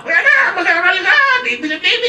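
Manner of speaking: high-pitched, loud scolding yell; angry parent. Acted. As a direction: scream at the child as a furious parent would. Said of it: "Galit-galit ka". (pasigaw na sermon ng galit na magulang)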